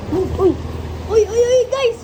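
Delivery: loud, high alarmed calling; alarmed shout. Real, not acted. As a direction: yell out in alarm. Water spilling, calling out.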